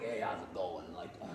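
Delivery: low, soft, bad detective impression; terrible Columbo. Detective showing up to ask questions, badly faked rumpled voice. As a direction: badly imitate a shambling TV detective. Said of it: "very terrible impression of Columbo".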